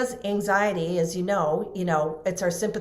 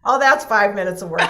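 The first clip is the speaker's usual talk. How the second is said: louder, breezy mimic of the trainer; imitating him; 2 semitones higher, 8 dB louder, faster than usual. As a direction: toss off "five minutes of work" loudly, mocking him. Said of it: "Can't you just hear him say that?".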